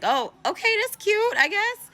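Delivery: mock a polite but dubious friend, voice climbing high; doubtful politeness.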